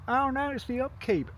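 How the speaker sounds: high-pitched mock protest; mocking excuse. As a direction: parrot a feeble excuse in a high voice. Mocks an excuse for toll.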